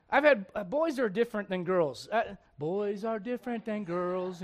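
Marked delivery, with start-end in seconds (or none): 2.6-4.4 put-on slow, softer children's-host voice; Mister Rogers. Preacher mimicking a kids' TV host, slow and soft. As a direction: say it gently and slowly, word by word. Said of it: "Mister Rogers, whatever, you know".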